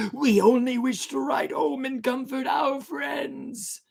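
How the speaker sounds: high, slow, loud ghostly call; drawn-out wailing sailor; eerie stranger. Acted. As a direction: phantom seaman pleading to the crew.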